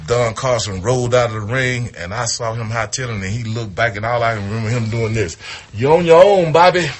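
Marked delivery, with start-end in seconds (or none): 5.7-6.9 higher, much louder call across the arena. Retold or imitated. Fleeing manager calling back to his wrestler, loud call. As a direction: yell it back while running off.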